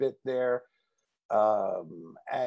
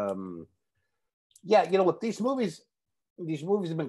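talking while chewing gum; 5 semitones higher than usual. Real, not acted. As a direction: chew gum between words. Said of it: "I'm gonna put a piece of gum in my mouth".

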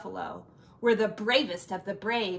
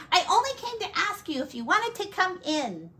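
higher, quick little frog; tiny frog voice; 10 semitones higher, faster than usual. Acted. The frog invites the bear and buffalo in, high and quick. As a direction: chirp it out fast and high.

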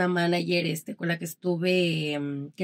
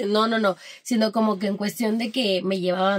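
ordinary delivery

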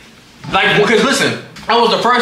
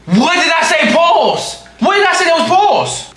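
indignant outburst; 10 semitones higher, slower than usual. Real, not acted. Streamer calling out his chat over "pause", indignant outburst. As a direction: pitch it way up as you call them out.

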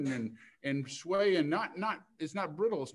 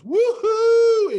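very high, loud whoop of triumph; whooping cry; 19 dB louder than usual. Acted. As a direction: let out a wild whoop. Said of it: "And she goes".

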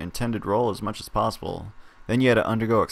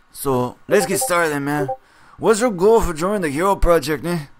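louder, higher character voice; brash interviewer voice; 7 semitones higher, 7 dB louder than usual. Acted. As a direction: play the pushy interviewer. Gigi the interviewer questions you.

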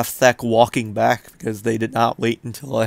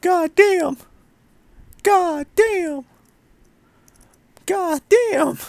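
much higher, cartoonish squeak; high squeaky voice; much slower than usual. Repeated exclamation in put-on voice.

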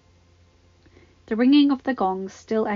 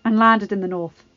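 nervous Baelish; 9 dB louder than usual. Acted. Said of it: "Gulping, he continued".

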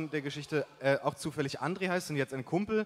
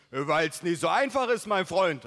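loud, higher heated retort; worked-up buddy; 4 semitones higher, 5 dB louder than usual. A narrator's reading. Buddy rejecting his friend's simple plan, worked-up voice. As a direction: get all fired up explaining. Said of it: "eiferte sich Mike".

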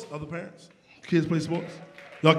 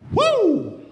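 mock woo; 13 semitones higher than usual. Mock celebration of AAU.

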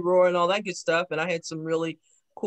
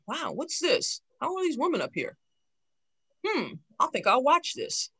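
wide-eyed wonder; 9 semitones higher than usual. Retold or imitated. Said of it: "And I'm going".

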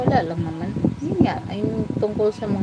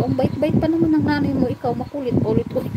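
mock reproach, pitched higher; playful nagging, raised pitch; teasing scold; 7 semitones higher than usual. Retold teasing scolding of partner.

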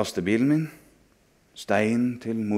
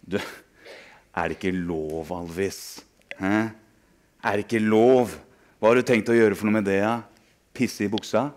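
mocking bully. Bully mocks the narrator.

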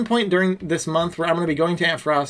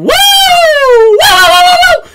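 very high, loud woo; excited high cheer; 12 dB louder, much slower than usual. Real, not acted. Celebrating sub anniversary.

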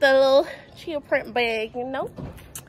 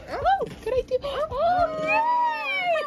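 excited squeal; slower than usual. Real, not acted. To the zoo keeper, thrilled to shake the monkey's hand, high squeal. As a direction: voice shooting up high in thrilled delight.

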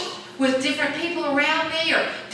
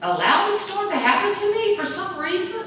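high, pleading questions to God; questioning prayer; 7 semitones higher than usual. Retold or imitated. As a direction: ask God, raised and searching. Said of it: "questioning God".

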